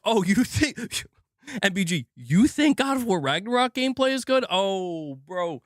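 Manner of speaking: high, incredulous mimicry of hecklers; mocking online critics. Streamer imitating people who argue with him about gameplay, mocking. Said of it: "they'll be like".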